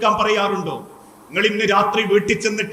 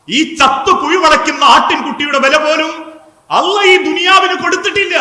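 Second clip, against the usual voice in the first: high, raised preaching voice, climbing to the end; impassioned repeat; 6 semitones higher, 4 dB louder than usual. Retold or imitated. Preacher repeating the Prophet's words to the congregation, impassioned. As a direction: drive it home higher and louder.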